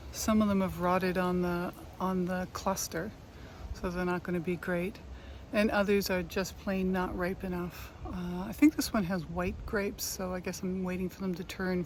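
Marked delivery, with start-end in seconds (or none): none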